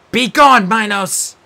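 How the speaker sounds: loud, high banishing order; demanding. Acted. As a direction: drive the ghost away. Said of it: "Nico demanded".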